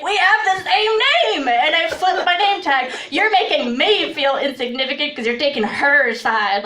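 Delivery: high, loud indignant protest; indignant outburst. Retold or imitated. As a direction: protest hotly to the manager. Indignant reply to manager.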